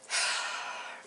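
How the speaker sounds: soft, unvoiced outbreath; sigh of relief. Real, not acted. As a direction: breathe out in relief. Imagining finally feeling good.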